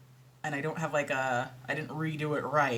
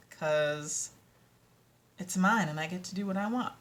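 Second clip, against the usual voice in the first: usual speaking voice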